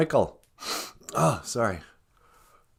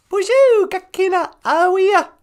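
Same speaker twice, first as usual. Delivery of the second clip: much higher, louder puppet voice; high puppet greeting; 7 dB louder than usual. Pretend. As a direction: greet everyone as a squeaky puppet.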